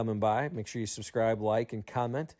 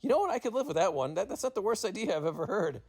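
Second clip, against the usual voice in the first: high-pitched retold reply; lively imitation of someone warming to an idea; animated retold quote; 9 semitones higher than usual. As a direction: quote him pitched up, giving in. Retold Mad Dog accepting the idea.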